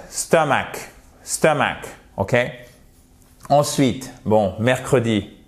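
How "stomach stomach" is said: "Stomach" as STUM-uk, the last vowel like a French E; weak second vowel. Shown as a demonstration. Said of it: "Ça ressemble un peu au E français".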